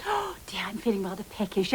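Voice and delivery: soft, breath-only aside; private murmur. Acted. Diner alone at the table feeling hungry, soft murmur. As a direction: murmur it to yourself.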